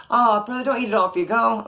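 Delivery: put-on wheedling complaint; guilt-tripping. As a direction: imitate a cellmate laying on the guilt. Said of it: "Making me feel guilty and shit".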